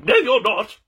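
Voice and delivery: high, loud mock refusal; hammed-up indignant shout; indignant Aunt Betsy. Aunt Betsy refuses to give up David, indignant shout. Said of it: "Aunt Betsy's like".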